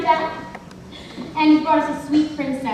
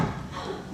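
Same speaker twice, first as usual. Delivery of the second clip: sudden gasp; 17 dB quieter than usual. Acted. Guessing game, sudden realisation.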